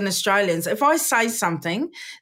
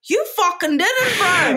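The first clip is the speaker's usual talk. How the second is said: incredulous Kiwi mate; 9 semitones higher, slower than usual. Retold or imitated. Mocking a Kiwi boasting mate.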